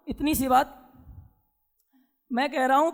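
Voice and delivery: normal talking voice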